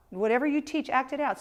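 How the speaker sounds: ordinary delivery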